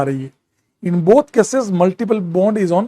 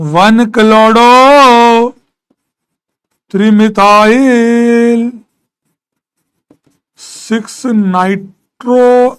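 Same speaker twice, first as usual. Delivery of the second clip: very slow and loud, spelling out each part; slow, emphatic dictation; 3 semitones higher, 7 dB louder, much slower than usual. Shown as a demonstration. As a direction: dictate each part slowly so the class can write it. Teacher dictating the final name.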